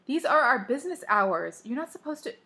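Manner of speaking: high-pitched indignant objection; indignant scolding. Acted. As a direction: scold a parent on the phone. Scolding mother for calling.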